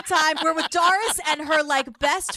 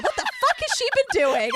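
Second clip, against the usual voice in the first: mock Jean; 11 semitones higher than usual. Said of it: "she's like".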